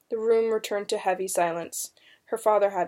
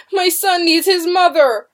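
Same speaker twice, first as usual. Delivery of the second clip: raised, pleading shout; desperate cry; 9 semitones higher, 9 dB louder than usual. A narrator's reading. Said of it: "William Good cried desperately".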